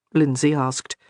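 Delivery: usual speaking voice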